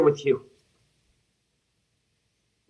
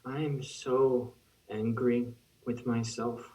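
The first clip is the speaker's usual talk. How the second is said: slow, much softer, lower self-reproach; a soft, slow seething admission; quiet anger; 7 semitones lower, 11 dB quieter, slower than usual. Acted. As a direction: turn the anger inward, low and slow.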